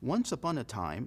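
slow fairy-tale "Once upon a time"; storyteller's opening line; storybook opening. Shown as a demonstration. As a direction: start a bedtime story. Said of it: "así empiezan las historias".

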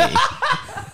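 squeaky high laugh. Real, not acted. Laughing at Tattoo's line, very high. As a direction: giggle at her own joke. Laughing after own quote.

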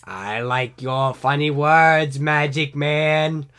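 very slow, loud drawn-out voice; character impression. Praising a magic man, slow loud put-on voice. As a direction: stretch every word right out.

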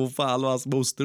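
ordinary talk

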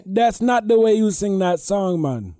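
put-on Jamaican accent. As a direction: put on a cartoonish Jamaican lilt. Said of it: "any type of Jamaican accent".

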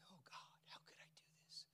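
soft unvoiced whisper of a broken man; imagined whispered prayer. Peter alone after the rooster, whispered remorse. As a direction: breathe it like a guilty confession. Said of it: "I can imagine".

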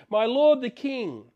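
calling out loud to the distant king. Retold or imitated. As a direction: call out across the distance, respectful but loud. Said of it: "he yells out and he says".

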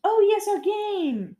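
high, drawn-out delighted remembering; light up at the reminder, pitch leaping; sudden excitement. Real, not acted. Host is reminded of the game, excited.

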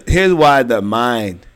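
ordinary talk

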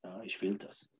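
softly, limply repeating the wish; a weak, fading "ich will das"; mock half-hearted wanting. Coach mocking someone who only wants it, said softly. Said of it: "Wollen bringt uns nicht weiter".

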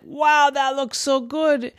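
high-pitched, delighted wow. A narrator's reading. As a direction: marvel at the cake. Kathy sees the cake.

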